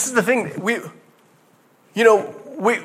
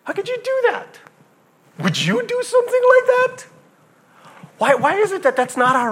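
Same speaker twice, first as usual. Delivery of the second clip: incredulous; faster than usual. Acted. Incredulous outburst.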